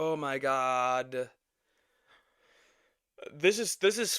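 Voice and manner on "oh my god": low, slow voiced groan; exasperated drawl. Real, not acted. Reactor worn out by the argument, weary drawn-out groan. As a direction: draw it out wearily.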